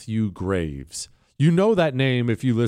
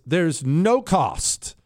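"no cost" drawn out slowly and higher; stress on NO COST; 5 semitones higher, much slower than usual. Shown as a demonstration. Host sells free courses to listeners, "no cost" stressed slow and high. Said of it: "I'm gonna stress this".

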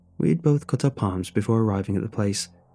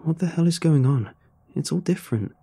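higher-pitched, startled question; bewildered alarm; 6 semitones higher than usual. A narrator's reading. Narrator finding the whole midway changed, bewildered. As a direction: react in disbelief, voice pitched up.

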